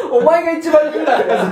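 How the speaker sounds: laughing retort, through giggles; a laughing jab back. Retold or imitated. Retorting to dad's speech.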